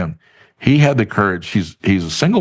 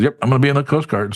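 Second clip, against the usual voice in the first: quick, higher-pitched imitation of eagerness; excited resolve; 6 semitones higher, faster than usual. Friend declaring his Coast Guard plan, excited. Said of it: "he got excited and says".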